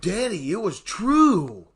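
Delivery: put-on high, slow little girl voice; innocent child voice. Daughter answering her dad, a slow, high, innocent child voice. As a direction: say it like a sweet, honest kid.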